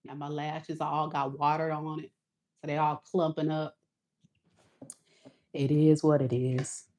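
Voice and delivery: soft, resigned and low; resigned after crying. Real, not acted. She shrugs off her teary face on camera, softly resigned. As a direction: let it go quietly. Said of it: "how raggedy I look".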